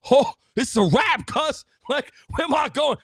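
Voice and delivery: high, slow mock despair; hammed-up "it's a wrap" panic; panicking. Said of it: "was like".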